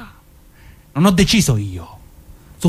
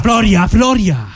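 calling out sharply; 9 semitones higher, slower than usual. Acted. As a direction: yell his name to stop him cold. Shouting to stop Floria.

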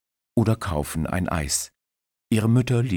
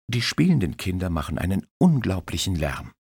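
ordinary delivery

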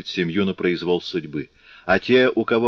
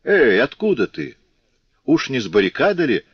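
high, swooping challenge to a stranger; suspicious guardsman; 6 semitones higher, 4 dB louder than usual. Acted. Guards stop a man from the barricade, suspicious challenge. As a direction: call out a pointed, suspicious question. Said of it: "Они-то уж заметят человека в блузе и фуражке".

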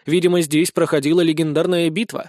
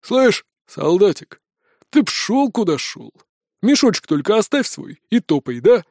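condescending bandit chief; 2 semitones higher than usual. Acted. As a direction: shoo the lad off like a thug.

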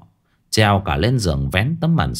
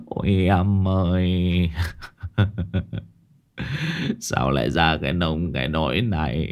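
sobbing lament; 6 semitones lower, 4 dB quieter than usual. Acted. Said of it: "miệng sụt sùi".

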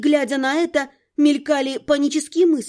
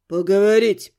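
low, menacing man's voice; curt, angry male reply; hissed reply; 5 semitones lower, 3 dB louder than usual. A narrator's reading. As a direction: hiss it through the door in a lowered voice. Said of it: "прошипел рыжеволосый".

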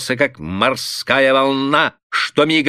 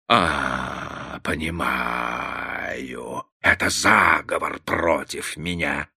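slow, low, quiet suspicion; lightly voiced father, grim and hushed; dark brooding; 8 semitones lower, 6 dB quieter, much slower than usual. A narrator's reading. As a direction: mutter it as if uncovering a plot. Father broods over a conspiracy.

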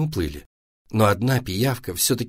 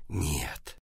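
usual speaking voice

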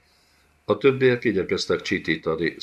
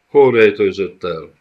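thundering demand; 3 semitones higher, 11 dB louder than usual. A narrator's reading. Gajag the beast thunders at the cowering gocsomul. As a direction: roar the question like a monster. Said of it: "mennydörögte Gajag".

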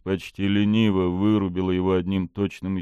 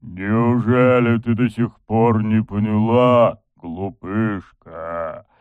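slow, drawn-out demonic taunt; 2 semitones higher, 5 dB louder, slower than usual. A narrator's reading. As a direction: savour every word like a demon. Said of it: "Это был голос демона".

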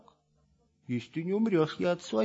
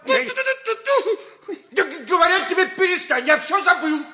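higher, louder, laughing exasperation; exasperated shout; 12 semitones higher, 12 dB louder, faster than usual. Acted. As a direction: shout him down through laughter. Exasperated Geronte shouts him down.

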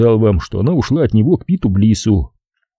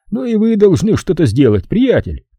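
Fowler the boss; 11 semitones higher than usual. Acted.

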